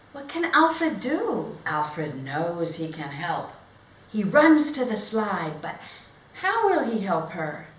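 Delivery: lively, sing-song story reading; reading to children. Acted. Teacher reading a picture book to her class, bright and animated. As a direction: read it like a storybook for little kids.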